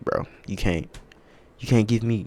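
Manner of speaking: ordinary delivery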